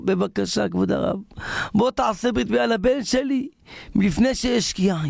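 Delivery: high, urgent acted plea; pleading father. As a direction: beg a favour, pitch climbing as you go. Father begging the rabbi for help.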